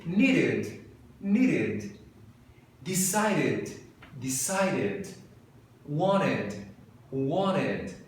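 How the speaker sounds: slow drill words in an American accent. Shown as a demonstration. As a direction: repeat each word like an American. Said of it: "com sotaque de americano".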